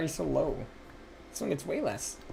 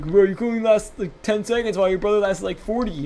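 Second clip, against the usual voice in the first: higher, louder teasing dad voice; mocking dad; 6 semitones higher, 5 dB louder than usual. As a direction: mimic dad rubbing it in. Dad teasing him, retold.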